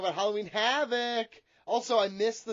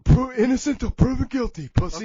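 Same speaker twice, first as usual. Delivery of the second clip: louder, higher put-on character voice, defiant; Big Johnny voice; 5 semitones higher, 6 dB louder than usual. Big Johnny denies the charge to the host, defiant character voice. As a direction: snap back in character like a shady wrestler. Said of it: "but Big Johnny".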